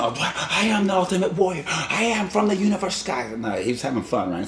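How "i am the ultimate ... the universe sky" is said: high hammed-up wrestler boast; a dog playing a cosmic champion, much higher; mock heroic wrestler proclaiming; ultimate warrior voice. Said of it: "he's doing the".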